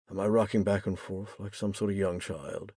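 ordinary delivery

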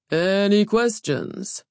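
slow, drawn-out official call; ceremonially stretched question; intoning. A narrator's reading. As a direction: intone it high and long. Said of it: "he intoned".

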